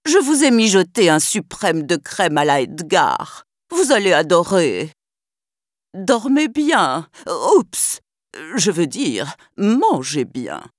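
falsely sweet scheming butler; purring villain, slow and sly; wicked stepmother. Acted. Butler villain speaking to cats.

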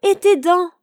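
high, loud alarmed cry. A narrator's reading. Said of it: "s'écria le petit chaperon rouge".

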